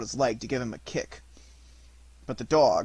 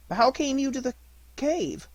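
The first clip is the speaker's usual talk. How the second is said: high puppet voice; 11 semitones higher than usual. Acted. Puppet asks dog.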